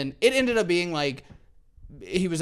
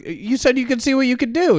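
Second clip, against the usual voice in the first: retold protest, high and louder; indignant protest; 10 semitones higher, 6 dB louder than usual. Driver protesting to the cop who wrote the ticket, indignant.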